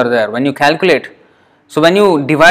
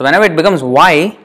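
usual speaking voice

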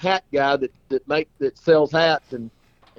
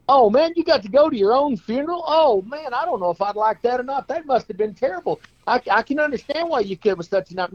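much higher, exaggerated sympathy; mock-shocked friend; 10 semitones higher than usual. Friend reacting to the clown's dream, mock shocked. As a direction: gasp it out in big sympathy.

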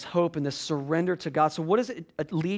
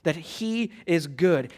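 ordinary delivery

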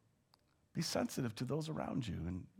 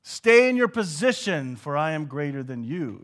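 haughty, superior put-down; arrogant crowd; 2 semitones higher, 11 dB louder than usual. Retold or imitated. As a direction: talk down to the beggars. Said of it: "is what they're saying".

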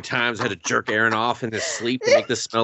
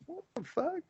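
shocked disbelief; 14 dB quieter than usual. Retold or imitated. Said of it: "I was like".

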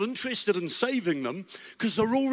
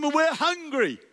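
higher, nagging whine; whiny complaint; 9 semitones higher, slower than usual. Acted. Israelites whining to Moses about food, mock whine.

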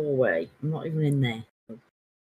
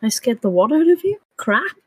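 surprised disbelief; 9 semitones higher, 9 dB louder than usual. Real, not acted. Mishearing, loud disbelief.

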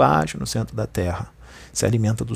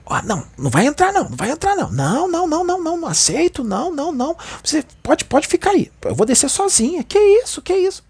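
much higher, louder, shrill imitated outburst; aggressive refusal; 3 dB louder, faster than usual. A woman in the elevator refusing to let them in, imitated high and aggressive. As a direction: mimic the woman refusing them, hostile and frantic. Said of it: "Com uma agressividade".

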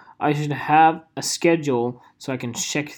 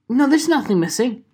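higher-pitched mock disbelief; acted-out denial; 8 semitones higher, 4 dB louder than usual. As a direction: play back a puzzled denial, pitched up. Acting out own first reaction.

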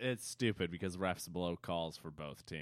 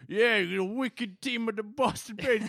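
hammed-up Boston accent, higher and louder; terrible Boston accent; 8 dB louder than usual. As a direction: overdo a bad Boston accent, shouting it. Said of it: "This is a terrible Boston accent".

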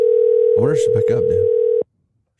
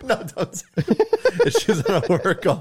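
laughing; 8 semitones higher than usual. Real, not acted. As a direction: laugh through the plea. Laughing while pleading.

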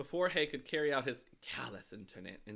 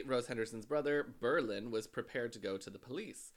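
ordinary talk

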